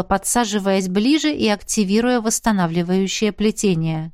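usual speaking voice

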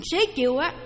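ordinary talk, nothing marked

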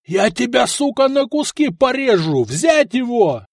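high, raging threat; furious yelling. A narrator's reading. Duke threatening the man on the wall, yelling furiously. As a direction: rage up at him, dragging out the final order. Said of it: "заорал Адаварий".

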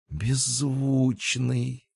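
slow, high, soft groan; groaning realisation.

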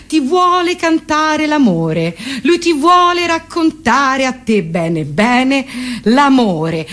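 usual speaking voice

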